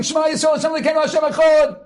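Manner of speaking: mock yell of the Shema; remembered yell. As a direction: ring the missing prayer out. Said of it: "the guy yelling".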